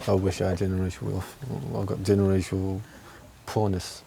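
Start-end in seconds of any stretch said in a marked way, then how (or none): none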